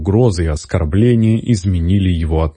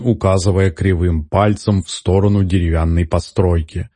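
ordinary delivery

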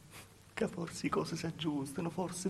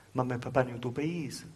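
ordinary talk, nothing marked

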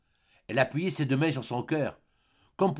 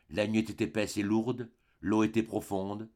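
ordinary delivery

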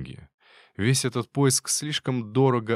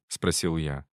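ordinary delivery, nothing marked

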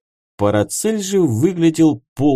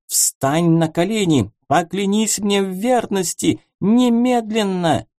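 higher, imperious princess voice; haughty princess; 7 semitones higher than usual. A narrator's reading. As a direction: command like a queen. Princess demands obedience.

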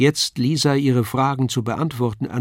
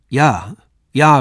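called out; 3 semitones higher, 8 dB louder than usual. Acted. Paul calls out eagerly to the young woman, louder. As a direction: call it out eagerly. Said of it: "rief ich".

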